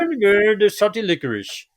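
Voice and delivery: hammed-up Swedish Chef gibberish. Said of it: "I'll just do Swedish chef".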